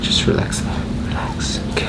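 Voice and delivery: soft whispered reassurance; whispering. Real, not acted. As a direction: breathe the words, no voice. Chiropractor calming patient before adjustment.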